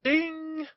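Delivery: high sung bell ding; bell imitation. Streetcar conductor rings bell.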